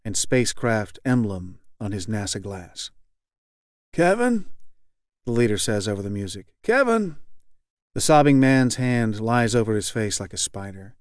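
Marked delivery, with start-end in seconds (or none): 3.9-4.5 high, gentle, bland call of the name; blandly kind call. Acted. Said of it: "very blandly and kindly". 6.6-7.2 high, gentle, bland call of the name; blandly kind call. Acted. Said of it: "very blandly and kindly".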